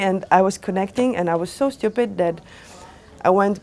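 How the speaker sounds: ordinary delivery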